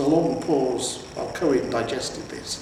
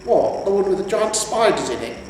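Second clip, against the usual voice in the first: higher, a touch of surprise; mildly surprised old hero; 7 semitones higher, 3 dB louder than usual. A narrator's reading. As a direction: ask it a little taken aback. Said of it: "Cohen looked up, surprised".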